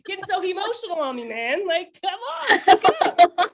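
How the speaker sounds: hammed-up scolding of a man, giggling; mock condescending, laughing. Mocking men's lines back at them.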